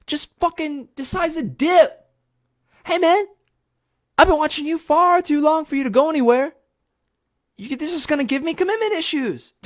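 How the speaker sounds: high, loud indignant squawk; mock outraged protest. Kid pleading with the TV host who walks out, mock outraged. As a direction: protest in a shrill-pitched, hammed-up panic.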